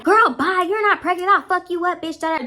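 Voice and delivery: high-pitched imitation of trash talk; high, mouthy put-on voice; mock dismissive. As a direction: mimic her brushing the girl off. Acted quarrel, Pick Me dismisses her.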